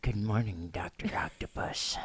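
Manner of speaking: breathy whispered Bale imitation. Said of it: "or he talks like this".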